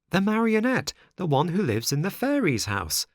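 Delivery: put-on high boy puppet voice; Pinocchio voice. A narrator's reading. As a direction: voice the eager puppet. Pinocchio explains.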